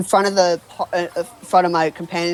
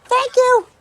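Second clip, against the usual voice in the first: high, squeaky nervous goblin; 4 dB louder than usual. Acted. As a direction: pitch way up, a timid little goblin. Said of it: "your goblin voice".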